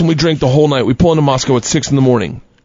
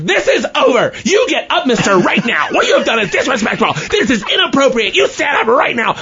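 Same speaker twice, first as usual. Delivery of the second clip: mock stern teacher, pitched up and laughing; a scolding teacher played for laughs, voice raised high; 9 semitones higher than usual. Teacher ordering the student up, mock stern and high.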